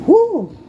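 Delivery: sharp high pained whew; loud yelp of pain from a burn; burnt hand. Real, not acted. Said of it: "Just burnt my, my hand".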